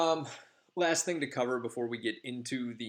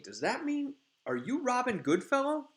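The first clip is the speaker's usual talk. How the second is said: high, loud, amazed question; starstruck fairy; 13 semitones higher, 4 dB louder than usual. Retold or imitated. A fairy realises she is talking to Puck, high and amazed. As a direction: gasp it out as you realise who it is. Fairy recognizes famous Robin.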